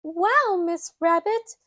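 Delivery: put-on high child voice praising Miss Rabbit; Peppa. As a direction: gush like an impressed little girl. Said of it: "Peppa says".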